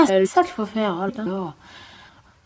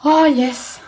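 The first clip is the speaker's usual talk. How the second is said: excited; 6 semitones higher, 3 dB louder than usual. Real, not acted. Reacts in game.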